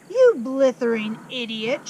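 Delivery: loud, slow, spitting insult; furious shout. Acted. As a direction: bellow it at the boy. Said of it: "shouted the Trunchbull".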